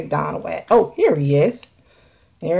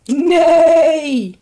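high, loud horse whinny; horse neigh; 10 semitones higher, 6 dB louder than usual. Pretend. Horse sound for kids.

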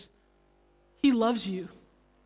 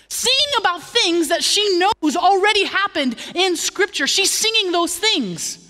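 louder, higher preaching push; preaching at full force; 7 semitones higher, 7 dB louder than usual. Acted. Pastor urging the church, preaching loud. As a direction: preach it with rising fire.

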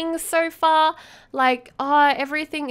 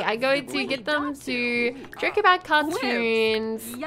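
ordinary talk, nothing marked

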